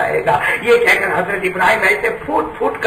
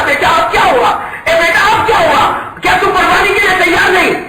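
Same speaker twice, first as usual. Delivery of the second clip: high, loud, urgent questioning; anguished cry; 10 semitones higher, 3 dB louder than usual. Acted. Father cries out asking if son is unready, loud anguish.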